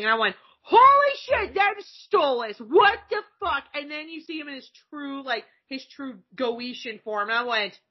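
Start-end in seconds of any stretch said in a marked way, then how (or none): 0.6-3.6 high, loud, slow disbelief; astonished outburst. Retold or imitated. Viewer recognising Stolas at his entrance, loud astonished outburst. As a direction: exclaim it high, stretching out the shock. Acted amazed reaction to an entrance.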